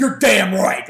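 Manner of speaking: imitated tough-guy outburst; retold in-your-face shout. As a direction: throw it out like a defiant wrestler. Austin in Vince's face, retold.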